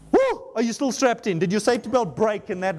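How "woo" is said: excited whoop. Real, not acted. Preacher excited after scripture reading.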